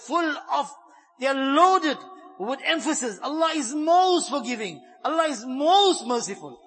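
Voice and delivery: loud, rising preacher's voice building emphasis; fervent preaching. Acted. Preacher urging sinners not to despair, loud and fervent.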